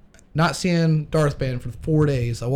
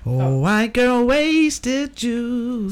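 put-on high, slow slurred party-girl voice; drunk party girl; 6 semitones higher, much slower than usual. Friends joking over drinks, in a hammed-up drunk party-girl voice. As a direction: play a tipsy girl at a party.

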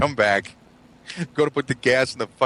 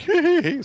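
gleeful giggle; 3 dB louder than usual. Retold or imitated. Husk's gleeful crowbar moment.

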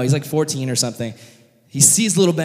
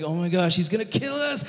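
high, hushed mock panic; anxious brothers' whine; freaked out; 7 semitones higher, 5 dB quieter than usual. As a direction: fret under your breath in acted dread. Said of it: "the brothers are freaked out".

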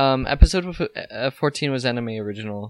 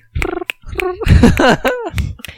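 cat noise; 11 dB louder than usual. Shown as a demonstration. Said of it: "That's a cat".

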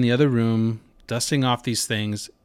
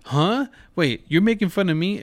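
mock surprise; 6 semitones higher than usual. Dad's surprised reaction retold.

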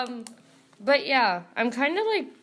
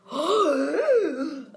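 excited gasp; 8 semitones higher, 6 dB louder than usual. Real, not acted. Excited about auditions.

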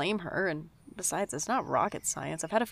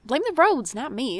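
higher, louder defensive protest; 7 semitones higher, 10 dB louder than usual. Acted. New worker defending being late to his friend, loud and high. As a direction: push back hard on the blame.

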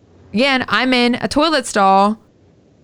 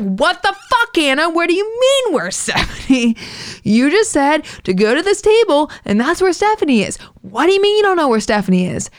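much higher, exasperated mock shouting; hammed-up angry yell, pitched way up; retold yell at Anna; 6 semitones higher than usual. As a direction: let the disbelief boil over at her friend. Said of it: "Sierra for yelling at Anna".

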